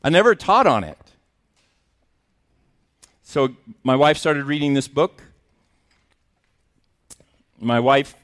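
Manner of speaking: ordinary delivery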